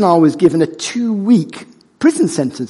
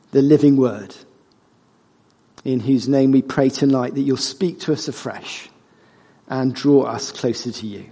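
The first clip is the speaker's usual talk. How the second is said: prayer; 4 semitones lower than usual. Real, not acted. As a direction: lead the congregation in prayer, voice lowering towards the amen.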